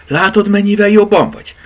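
high, loud, pleased exclamation; delighted. A narrator's reading. Mama seeing Bogi feel better, delighted. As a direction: cheer the child's recovery.

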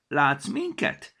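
slow, high wondering question; asking gnome girl. A narrator's reading. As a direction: ask it slowly, rising in wonder. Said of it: "kérdezte egy csíkos sapkás manólány".

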